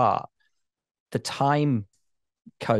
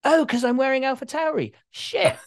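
sudden realization; 13 semitones higher than usual. Retold or imitated. Retold realization at airport security.

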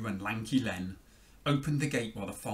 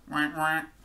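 two quick, higher quacks; imitated duck call; 5 semitones higher, 4 dB louder than usual. A narrator's reading. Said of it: "said the duck".

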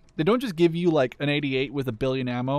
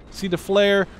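ordinary talk

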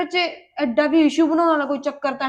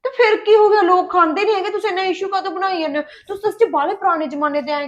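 indignant outburst; 6 semitones higher than usual. Acted. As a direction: throw the question back at him, pitched up. Wife's quarrel with husband.

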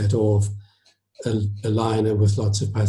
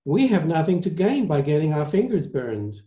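higher-pitched surgeon's rebuttal; argumentative surgeon; 6 semitones higher than usual. Acted. Surgeon rebuts the maestro about war, higher voice. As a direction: dismiss the idea as an isolationist.

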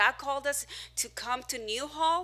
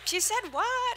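mock confused listener; 10 semitones higher, 4 dB louder, slower than usual. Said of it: "Another guy".

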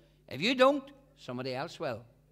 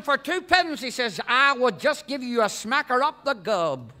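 louder, menacing threat; mock menacing Yank; angry Yank threat; 3 semitones higher, 6 dB louder than usual. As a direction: tell him off like you mean to thump him.

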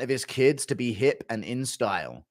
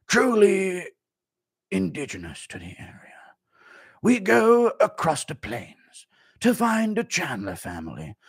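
slow, hushed nature documentary host; a hushed safari guide voice; safari narrator; slower than usual. Acted. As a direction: narrate the wild like a wildlife show. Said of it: "Impression of the Chandlers on Urban Safari".

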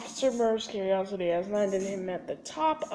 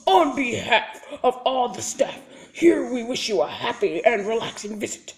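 louder announcer read with a laugh in it; welcome announcement; 2 semitones higher, 10 dB louder than usual. Acted. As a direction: read it like a cheerful prison tannoy. Reads the prison welcome text.

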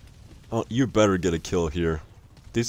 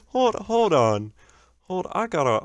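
amused disbelief; 10 semitones higher than usual. Real, not acted. Still cracking up after death.

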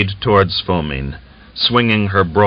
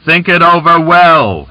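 louder, higher call to the crowd; crying out; 9 semitones higher, 6 dB louder than usual. A narrator's reading. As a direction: call out over the dispersing crowd. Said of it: "he cried".

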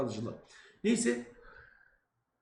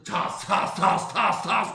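imitated spray bursts, hissed then louder; spraying noise; 3 semitones higher, 4 dB louder, much slower than usual. Father spraying the precious perfume, imitated spray sounds. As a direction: mimic a perfume bottle spraying.

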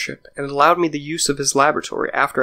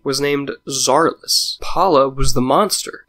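ordinary delivery, nothing marked

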